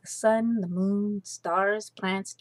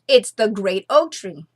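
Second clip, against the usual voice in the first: bold false answer; 10 dB louder than usual. A narrator's reading. Sisters answer with false confidence.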